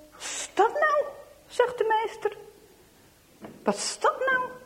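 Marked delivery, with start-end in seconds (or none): 0.1-1.0 high-pitched, puzzled exclamation; acted teacher's surprised question; startled teacher. Teacher interrupted by banging on door. 3.6-4.5 high, baffled teacher's question; acted surprise at an interruption; startled teacher. Teacher repeats question at the noise.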